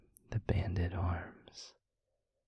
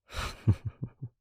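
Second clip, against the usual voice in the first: breathy laugh; 5 semitones higher, 6 dB louder than usual. Acted. Laughs while showing it off.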